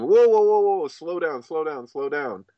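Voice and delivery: high, loud whoa easing into slow; high, loud call to slow down, fading; urging slow down. Retold or imitated. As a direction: loudly halt them, then slow down. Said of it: "Krishnamurti all the time was emphasizing".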